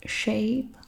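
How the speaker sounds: normal talking voice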